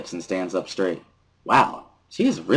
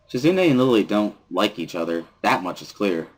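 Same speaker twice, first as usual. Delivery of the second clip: ordinary delivery, nothing marked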